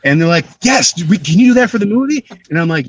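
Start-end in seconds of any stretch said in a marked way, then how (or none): 0.6-2.2 higher, eager request; retold excited casting director. Casting people to an actor they love, excited higher plea. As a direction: imitate delighted filmmakers, pitch jumping up.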